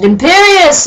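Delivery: high, loud hammed-up spell cast; shouted spell. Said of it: "Voldemort shouted".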